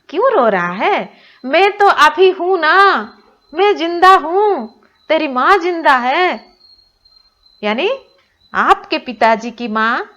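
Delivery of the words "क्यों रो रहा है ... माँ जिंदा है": insistent granny. Acted. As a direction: reassure her son as granny. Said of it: "दादी माँ ने कहा".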